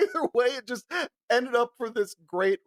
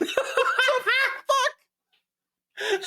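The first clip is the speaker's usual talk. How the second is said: high, laughing shocked cry; cracking up in disbelief; much slower than usual. Retold or imitated. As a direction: squeal it through laughter. Shocked reaction while laughing.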